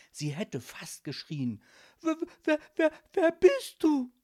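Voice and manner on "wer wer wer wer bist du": high, loud, trembling stutter; frightened girl, tripping on the first word; shaky stammer. A narrator's reading. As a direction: stammer in fright before forcing the question out. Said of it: "stammelte sie mit zittriger Stimme".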